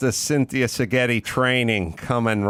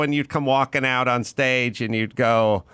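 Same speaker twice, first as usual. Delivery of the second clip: normal talking voice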